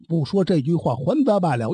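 ordinary delivery, nothing marked

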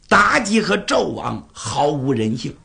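ordinary delivery, nothing marked

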